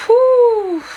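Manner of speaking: high, loud whew of release; exasperated whew after ranting; sharp exhale. Real, not acted. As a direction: blow it all out in one high breath. Blowing off steam after rant.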